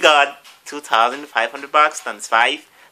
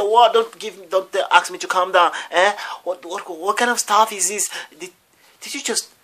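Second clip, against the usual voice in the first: high, spluttering indignant retort; acted outrage; 7 semitones higher than usual. Man told to calm down by school staff, acted outrage. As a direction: refuse to be calmed, pitched high.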